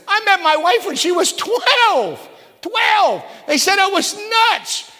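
preaching shout. Real, not acted. Preacher telling the church about his wife, shouting slow. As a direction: build it to a cry.

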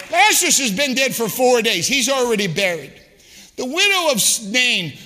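usual speaking voice

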